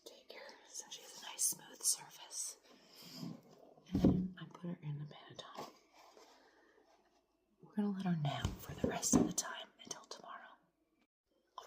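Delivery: late-night whisper so nobody wakes; hushed whisper, parents asleep nearby. Real, not acted. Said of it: "Sorry, I'm whispering".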